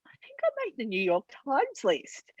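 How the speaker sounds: whispered, awed confession; whispering big news to a friend; hushed whisper. Retold or imitated. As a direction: breathe it like a secret. Telling friend secret news.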